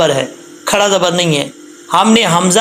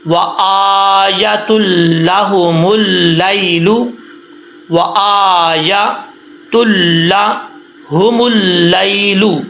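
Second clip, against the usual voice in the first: slow, drawn-out Quranic recitation; much slower than usual. Shown as a demonstration. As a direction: recite the verse slowly and carefully. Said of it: "मेरे साथ पढ़ना है आपने". (धीमी तिलावत)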